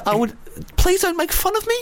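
high mock New Zealand girlfriend; New Zealand accent. Girlfriend objecting to being mocked, New Zealand accent. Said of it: "making fun of my girlfriend".

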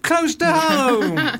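high, slow, drawn-out punchline. Acted. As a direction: stretch the words teasingly.

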